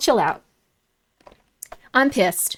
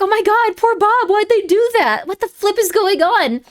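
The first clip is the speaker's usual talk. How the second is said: high, loud, whiny dismay; imitating upset reader, high and slowed; mock distress; 8 semitones higher, 3 dB louder than usual. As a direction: wail it like a fan betrayed. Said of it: "So I was really sad".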